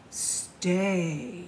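plain reading voice

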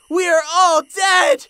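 slow, loud cry of doom; despairing wail. Acted. Fluttershy finding Twilight turned to stone, despairing wail. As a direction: wail it like the end has come.

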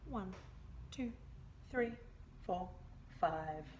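ordinary delivery

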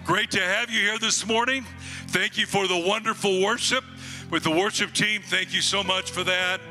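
ordinary delivery

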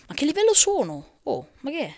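high, loud disbelief; surprised shout. Real, not acted. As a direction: yelp the question. Surprise at level number.

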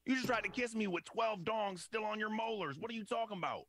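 high-pitched exasperated rant; indignant retort. Retold or imitated. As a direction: act out disbelieving outrage at her. Acted quarrel with crying girlfriend.

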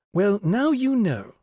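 put-on high, loud, slow lead-in to children; familiar teacher's voice. A narrator's reading. Teacher addressing her class, high slow voice. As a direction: open the lesson high and drawn out. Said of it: "a familiar voice saying".

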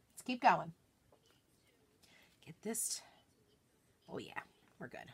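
quick whisper to herself. Real, not acted. Crafter focusing on the stencil, whisper. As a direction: whisper it under the breath.

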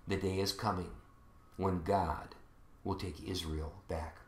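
low, soft, measured scripture reading; solemn preaching. Acted. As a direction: let each phrase settle quietly, with long pauses.

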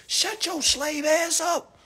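ordinary talk

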